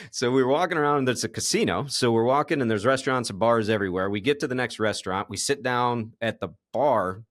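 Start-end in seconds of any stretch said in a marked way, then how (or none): none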